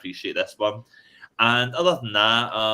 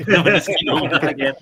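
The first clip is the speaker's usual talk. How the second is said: laughing; 7 semitones higher, 7 dB louder, faster than usual. Real, not acted. Laughing mid-story.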